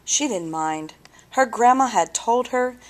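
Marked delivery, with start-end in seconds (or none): none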